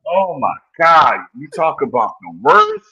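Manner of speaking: ordinary talk, nothing marked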